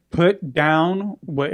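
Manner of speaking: very slow, spaced-out police command; cop voice. Retold or imitated. Cop ordering a man to drop it, cop voice. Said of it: "starts speaking in the cop voice".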